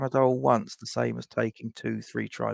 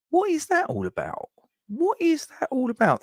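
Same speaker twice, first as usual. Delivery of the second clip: baffled disbelief. Real, not acted. Streamer baffled by a medical in London, high incredulous squeal. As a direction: pitch shoots way up in outraged disbelief.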